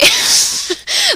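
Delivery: real high-pitched burst of laughter; helpless giggle between lines; laughing. Real, not acted. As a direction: crack up mid-reading. Reader breaks up mid-story.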